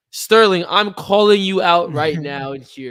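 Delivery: loud challenge to a fighter; mock fight call-out. Podcaster calling out Sterling for fun, loud mock challenge. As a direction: call him out loudly, playing the challenger. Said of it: "Yo, watch this".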